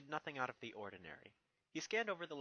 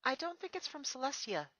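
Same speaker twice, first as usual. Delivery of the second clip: higher, lightly voiced young mare; a thoughtful pony princess, pitched up; Twilight's voice; 13 semitones higher, 4 dB louder than usual. Twilight answers Spike, lightly voiced and higher.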